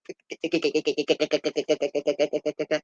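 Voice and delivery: long rapid-fire stuttered "keke" sound; vocal sound effect, steady rattle; imitated rattling noise. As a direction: rattle it out like a toy gun. Imitates everybody's noise in film.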